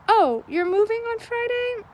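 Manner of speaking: high-pitched, rising mock surprise; imitated surprise. Shop staff hearing of a customer's move, imitated high surprise. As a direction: play the helpful staffer caught by news, voice jumping up.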